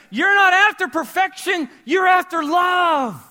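slow, loud and high; the climax of the point, belted out; preaching shout. Real, not acted. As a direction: cry it out to the room.

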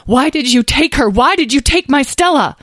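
loud, high, desperate outburst; unable to speak calmly. A narrator's reading. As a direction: demand answers as a distraught mother. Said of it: "unable to speak calmly".

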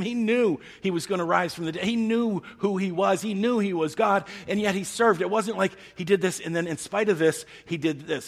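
high, loud, driving sermon climax; full-force preaching. Acted. Preacher driving the point home to the congregation, high and loud.

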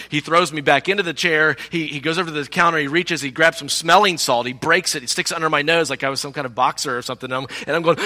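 usual speaking voice

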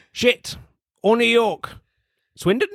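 hammed-up [name] in costume. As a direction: camp it up to the crowd. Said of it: "in his Queen Elizabeth costume and says".

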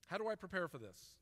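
usual speaking voice

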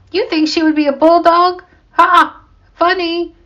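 high, hooting disbelief; amused mockery. A narrator's reading. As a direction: crow at a friend's silly answer. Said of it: "Sam laughed".

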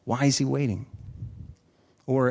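ordinary delivery

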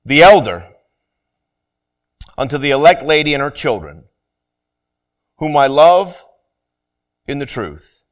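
scripture reading. Acted. Pastor reading the epistle to his congregation, measured and solemn. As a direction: lay each phrase out for the church.

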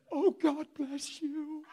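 high, very slow, drawn-out blessing; imitated grateful grandma. Preacher shows grandma's response to him, imitated. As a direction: draw it out high in overcome relief. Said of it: "This is exactly what she did".

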